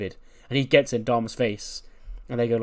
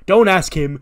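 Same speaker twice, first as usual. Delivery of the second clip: loud, higher-pitched command; angry interruption; 7 semitones higher, 11 dB louder, slower than usual. Acted. Ray decks Nese and rounds on his son, angry shout. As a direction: cut in sharply with raised voice.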